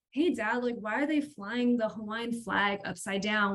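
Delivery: usual speaking voice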